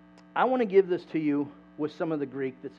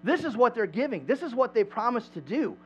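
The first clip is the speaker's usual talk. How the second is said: higher, animated retelling; lightly acted enthusiastic boasting; imitating excited bragging; 6 semitones higher, 4 dB louder than usual. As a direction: talk them up like an eager promoter. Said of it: "he's been telling people".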